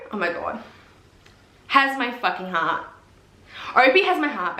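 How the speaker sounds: ordinary talk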